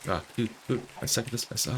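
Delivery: ordinary talk, nothing marked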